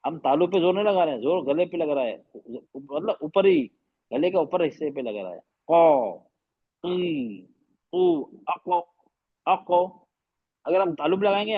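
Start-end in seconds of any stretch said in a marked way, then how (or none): 5.7-9.8 bounced qaf from the throat, अको with an echoing release; demo of qaf sound. Shown as a demonstration. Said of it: "ऐसे होगा".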